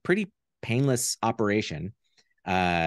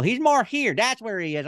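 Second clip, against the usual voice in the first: much higher silly puppet voice, searching for it; finding the puppet voice; 13 semitones higher, 3 dB louder than usual. Shown as a demonstration. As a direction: pitch the character up until it sits right. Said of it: "forgot how to do his voice".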